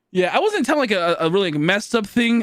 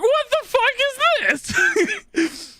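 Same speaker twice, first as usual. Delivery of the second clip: shrieking much higher in disbelief, slowly drawn out; shocked outburst; much slower than usual. Retold or imitated. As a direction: squeal it like you can't believe the photo. Retold shock at the photo.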